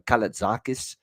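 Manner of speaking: ordinary talk, nothing marked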